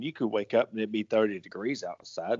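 ordinary talk

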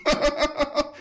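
short, high, incredulous laugh. Real, not acted. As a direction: laugh at the foolishness. Laughing disbelief at voters.

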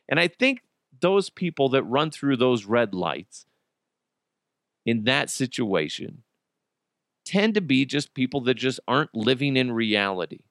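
usual speaking voice

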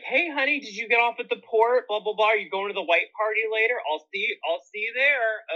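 high, sing-song camp voice; flirty camp greeting. Retold or imitated. Imitating himself greeting a gentleman on the cruise. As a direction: charm the old man in the elevator. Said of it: "And I'm like".

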